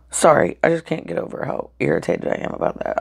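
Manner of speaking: normal talking voice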